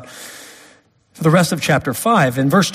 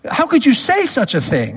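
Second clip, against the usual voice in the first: outraged rebuke; 7 semitones higher than usual. Retold or imitated. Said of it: "It's like".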